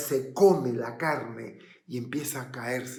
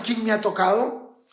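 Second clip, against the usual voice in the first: incredulous echo, raised and rising; Pedro; 6 semitones higher, 5 dB louder than usual. Acted. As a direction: throw the question back in disbelief. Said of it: "Y tú preguntas". (pregunta incrédula, con final ascendente)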